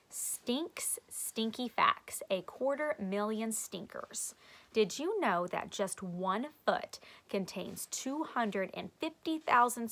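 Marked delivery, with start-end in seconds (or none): none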